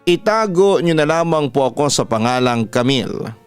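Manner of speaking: ordinary delivery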